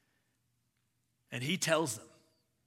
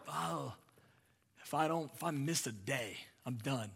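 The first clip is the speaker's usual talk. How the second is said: softer, fretful believer worrying; anxious hushed quote; 8 dB quieter than usual. Retold or imitated. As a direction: fret quietly as if afraid.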